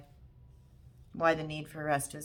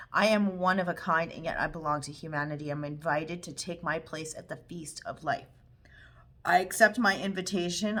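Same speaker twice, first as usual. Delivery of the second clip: affirmation read aloud; 7 dB louder than usual. Shown as a demonstration. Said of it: "Say this aloud".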